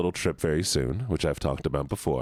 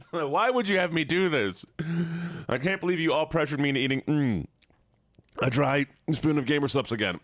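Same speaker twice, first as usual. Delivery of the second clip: sour mouthful whine; 9 semitones higher than usual. Real, not acted. Complaining while tasting sour powder.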